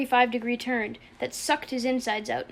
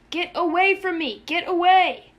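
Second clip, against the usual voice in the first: high, loud desperate yell; frantic shout; 7 semitones higher, 7 dB louder than usual. Acted. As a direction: shout to drive him off. Punching him, shouting through tears.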